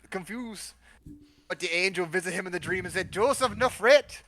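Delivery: mock Jamaican accent building in pitch towards the end. Guest reads about Mary, Joseph and the angel, Jamaican accent. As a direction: narrate with an amateur Jamaican lilt. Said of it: "but in a Jamaican accent".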